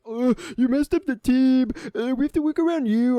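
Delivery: high, loud mocking imitation; mocking complainer. Mocks teammate's complaint while ranting.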